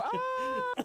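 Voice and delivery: high-pitched, loud scream; startled scream. Acted. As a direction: let out a sudden high yell. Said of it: "I, I go".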